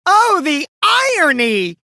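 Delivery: high, slow, sing-song mock; mocking the detective with a sing-song cry; theatrical mockery. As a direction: ham up the irony. Mocking exclamation.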